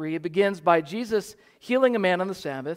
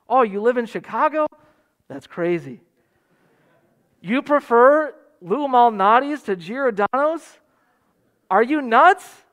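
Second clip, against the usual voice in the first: mock incredulous; 4 semitones higher, 7 dB louder than usual. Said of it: "We make rational judgments about".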